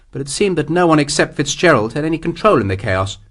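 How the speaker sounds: plain reading voice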